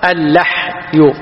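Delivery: "اللحي" very slowly, split as al-LAH ... yu; slow, separated "اللحي", lah then yu; fatha on the lam. Shown as a demonstration. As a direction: demonstrate "اللحي" syllable by syllable with an a. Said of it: "ها، لاحظ".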